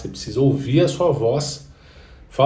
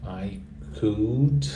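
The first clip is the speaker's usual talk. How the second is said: ordinary delivery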